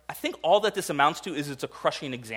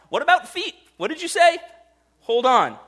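much higher, louder startled questions; mock shock; 12 semitones higher, 6 dB louder than usual. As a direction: recoil as if stunned. Feigned shock at the feet.

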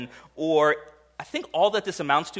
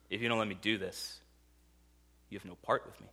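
much softer, lower, grave; solemn Jesus; 6 semitones lower, 12 dB quieter, faster than usual. Retold or imitated. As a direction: say it quietly and gravely. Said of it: "And Jesus responds by saying what".

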